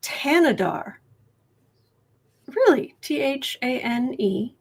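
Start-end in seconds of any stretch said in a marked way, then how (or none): 0.0-0.9 "Tanadar" opening on a plain T sound; silent-H pronunciation. Shown as a demonstration. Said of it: "It's pronounced".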